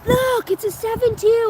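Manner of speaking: put-on high, loud excited child pointing out a truck; excited kid voice. Son pointing at a pickup, excited high child voice. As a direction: squeal like an eager kid spotting a diesel. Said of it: "Ringo loves every fucking diesel pickup".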